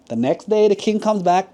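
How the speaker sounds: plain reading voice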